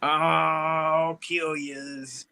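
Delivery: very slow, gravelly, menacing drawl; hammed-up gravelly outlaw threat; Sling Blade impression. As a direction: drag out the threat like a slow-witted killer. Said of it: "she's sounding like a Sling Blade".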